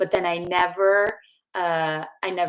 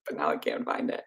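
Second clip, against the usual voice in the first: whispered under the breath; 7 dB quieter than usual. Real, not acted. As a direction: mutter it to yourself. Muttering to herself while searching.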